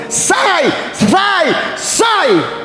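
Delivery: hammed-up disciple yelling at the demon; mock shouted exorcism. (grito exagerado de discípulo expulsando demônio)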